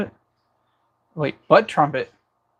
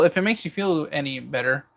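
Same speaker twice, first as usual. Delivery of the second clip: usual speaking voice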